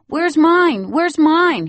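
put-on very high, loud little-boy clamor; excited child demand. Little boy jumping up for his gift, loud and eager. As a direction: bounce and demand your present.

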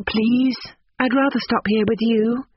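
put-on high, girlish plea; young lady's pleading voice, pitched well up. A narrator's reading. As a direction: beg to be allowed to stay. Girl pleads to stay.

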